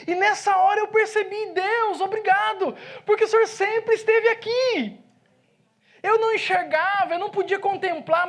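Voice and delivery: high, loud, fervent pulpit preaching; preaching at full force. Acted. Pastor testifying to his congregation, preaching at full force. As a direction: proclaim it to the whole church, full voice.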